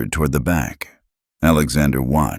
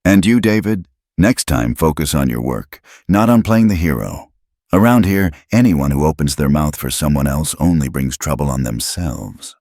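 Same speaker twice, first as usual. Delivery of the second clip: plain reading voice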